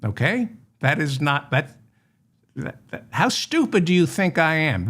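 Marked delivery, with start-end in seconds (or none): 3.1-4.9 mock indignant. Scolds the forger.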